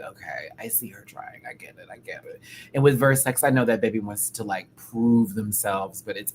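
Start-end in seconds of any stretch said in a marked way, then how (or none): 0.0-2.4 hushed whisper to herself; soft whispered inner thought; whispered aside. Retold or imitated.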